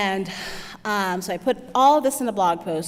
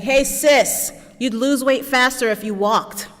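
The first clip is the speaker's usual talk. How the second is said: passing guy; 5 semitones higher, 6 dB louder, slower than usual. Retold or imitated. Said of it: "He was like".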